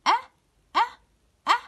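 short open "أ" repeated separately; three separate short "أ" sounds; hamza with fatha. Shown as a demonstration. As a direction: sound out "أ" alone, cut short each time. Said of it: "فتحة".

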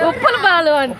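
laughing. Real, not acted. Mother laughing at silly name.

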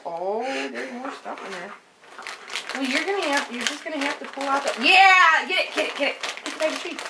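normal talking voice

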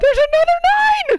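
very high excited yell; excited shout. Real, not acted. Repeats louder.

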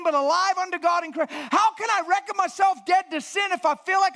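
loud, high, driving pulpit delivery; full-force preaching. Acted.

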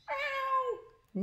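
very high, long meow; thin mock cat cry; cat wail. Narrator voices terrified cats afloat.

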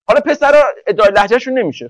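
ordinary delivery

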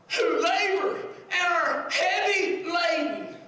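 loud, high-pitched preacher's shout; impassioned pulpit shout; full-force preaching. Real, not acted. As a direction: drive it home to the congregation at full volume.

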